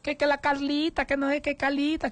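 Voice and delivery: high, whimpering mock lament; mock crying mother. Host mocking the mother who goes around crying over her children. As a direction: whine it high like a fussing mother. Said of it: "que pasa llorando".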